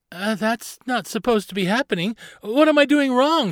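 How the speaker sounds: put-on high-pitched, dismayed child voice; worried girl captain. Carly asks Barnabas as boat drifts, high and worried.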